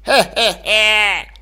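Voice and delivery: put-on slow spiteful old cackle; old man cackle. A narrator's reading.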